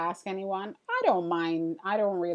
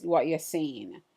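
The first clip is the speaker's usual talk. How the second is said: ordinary talk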